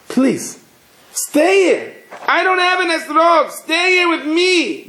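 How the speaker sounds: high-pitched, insistent begging; urgent pleading. Acted. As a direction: act out a desperate appeal to stay. Rebbe begs visitor to stay, acted.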